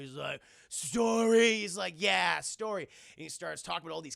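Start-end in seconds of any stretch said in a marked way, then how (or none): 0.7-1.5 loud, drawn-out one-word question; childlike giant robot. Retold or imitated. Iron Giant asking Hogarth about the story, childlike. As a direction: echo it like a curious giant.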